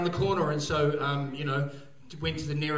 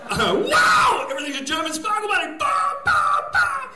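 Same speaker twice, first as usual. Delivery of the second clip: high, shouted rave euphoria; ecstatic clubber; 7 dB louder than usual. Acted. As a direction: yell and whoop like someone high at a rave. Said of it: "the one guy here is taking ecstasy".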